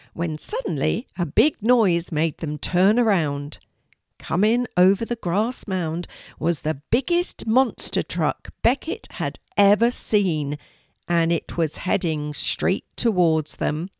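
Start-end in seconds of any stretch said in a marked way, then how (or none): none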